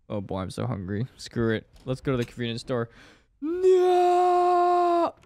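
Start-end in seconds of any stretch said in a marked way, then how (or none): 3.4-5.1 high, loud wailing cry of despair; anguished cry. Acted. Game ends in disaster.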